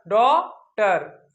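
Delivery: "डॉटर" split slowly into daw and tar; syllable by syllable. Shown as a demonstration. Teacher drills pupils on the word, slow "डॉटर" in two parts. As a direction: hold daw in "डॉटर", pause, then tar. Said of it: "क्या?".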